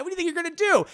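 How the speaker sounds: high, loud, incredulous challenge; acted standoff jeer; defiant taunt. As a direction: dare him to try it.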